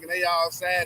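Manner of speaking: ordinary talk, nothing marked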